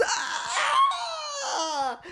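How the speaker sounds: soft, very high mock scream; imitated scream. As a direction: imitate an old lady screaming, volume held down. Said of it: "and then she screams".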